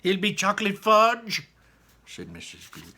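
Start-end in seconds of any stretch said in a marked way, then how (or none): 0.0-1.5 high, loud insistence; high loud mother; insistent mother. Acted.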